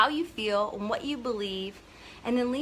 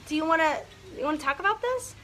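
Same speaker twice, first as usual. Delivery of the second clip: hesitant, higher-pitched mock pitch; timid approach; 4 semitones higher than usual. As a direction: act out an unsure salesperson. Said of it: "you're gonna be like".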